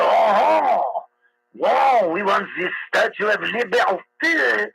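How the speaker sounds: hammy mock French accent, drawn out. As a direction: imitate a Frenchman with a thick movie French accent. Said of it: "Here's what old, uh, Raphael".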